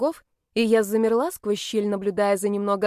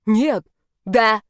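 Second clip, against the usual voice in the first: loud, curt yes-no squabble; heated, stubborn exchange; bickering; 7 dB louder than usual. A narrator's reading. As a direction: fire contradictions back loudly. Back-and-forth bickering escalates.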